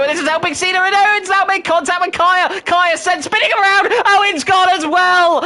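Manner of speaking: shouting excited race call; motorsport commentator. Acted. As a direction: call the crash loud and high.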